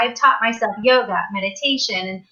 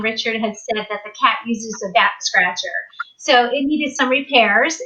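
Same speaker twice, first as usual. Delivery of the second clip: normal talking voice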